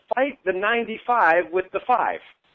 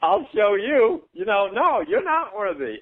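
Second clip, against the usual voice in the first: higher, slow, defiant inner voice; stubborn subconscious; 4 semitones higher, slower than usual. Retold or imitated. The subconscious defying positive affirmations, slow defiant higher voice. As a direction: dig your heels in and sneer it slowly. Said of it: "digs his heels in and says".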